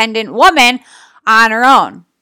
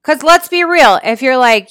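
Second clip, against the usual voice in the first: usual speaking voice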